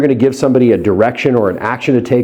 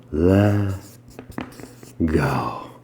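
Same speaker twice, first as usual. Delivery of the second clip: much lower and softer, drawn out; deeper hypnotic let go; 7 semitones lower, 9 dB quieter than usual. Shown as a demonstration. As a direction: sink fully on go. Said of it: "I'll even double it up".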